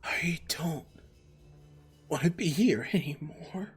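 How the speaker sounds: unvoiced, breathy spirit whisper. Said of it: "You begin to hear".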